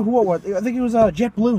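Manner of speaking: usual speaking voice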